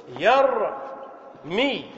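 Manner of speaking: يرمي stretched very slowly, syllable by syllable; drawn out. Shown as a demonstration. Teacher to students, very slow يرمي. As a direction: draw out يرمي so the class hears every sound. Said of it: "لاحظوا".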